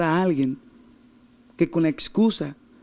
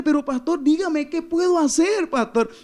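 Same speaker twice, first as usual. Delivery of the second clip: imitated pleading; 10 semitones higher than usual. Woman begs her pastor for advice, imitated pleading. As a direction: act out her begging, pitched high.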